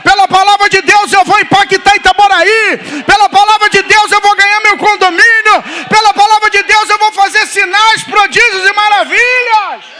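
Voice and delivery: high, shouted faith declarations; full-force preaching. Real, not acted. Preacher leads congregation in declarations, fervent shouting. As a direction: hammer each declaration out at the top of your voice.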